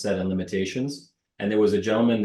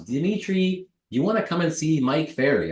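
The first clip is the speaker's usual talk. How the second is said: high-pitched mimicry of a mortgage broker; high put-on voice of a pal; Ted; 6 semitones higher than usual. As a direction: pitch it high, imitating a friend's invitation. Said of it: "This is exactly how he sounds".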